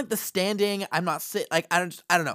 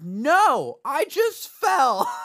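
higher, slow protest; indignant denial; 9 semitones higher, 4 dB louder, much slower than usual. Retold or imitated. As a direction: insist you just fell.

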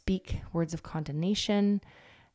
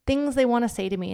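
ordinary delivery

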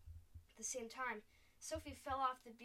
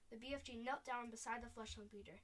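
plain reading voice

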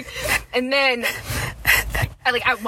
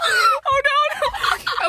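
shocked shriek; much slower than usual. Real, not acted. Reacting to shocking story.

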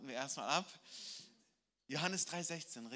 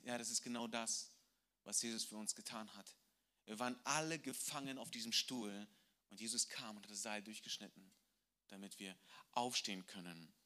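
softer and lower, sincere; tender explanation; 6 semitones lower, 6 dB quieter than usual. Acted. Missionary explains Jesus to the drunk man, softly. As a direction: let it land quietly.

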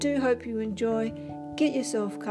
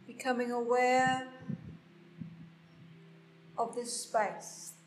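guided meditation; 8 dB quieter than usual. Acted. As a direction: guide them gently into stillness. Leads a meditation.

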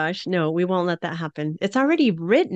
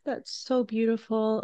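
moved to tears; 2 semitones higher, 4 dB quieter than usual. Real, not acted. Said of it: "you've made me cry a lot today".

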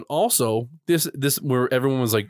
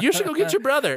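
high, nagging mom impression; high mock mother calling her kid; mom's voice; 13 semitones higher than usual. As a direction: squeak it out like a fussy mom. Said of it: "the mom's like".

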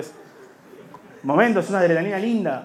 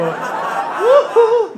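very high falsetto yelps; high falsetto whoops; 5 dB louder than usual. Retold or imitated. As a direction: squeal it like the pop star.

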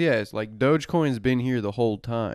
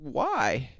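high hammed-up newsreel why; one word in the old narrator voice; mock announcer; 13 semitones higher than usual. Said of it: "Why do you speak like that?".

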